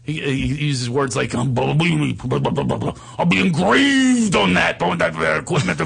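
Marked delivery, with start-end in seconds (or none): 1.3-5.8 babbled, stammering impression; hammed-up mush-mouthed talker; Steiny. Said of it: "he talks like this".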